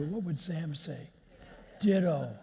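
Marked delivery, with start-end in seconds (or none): 1.8-2.3 punchy, louder one-word comeback; offhand stock reply. Retold or imitated. Sam's usual answer to Molly's love, brisk and loud. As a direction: toss it out loudly as a punchline.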